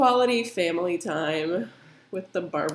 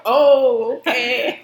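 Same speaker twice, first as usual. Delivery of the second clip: mock surprise; 5 semitones higher, 12 dB louder, much slower than usual. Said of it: "I'm like".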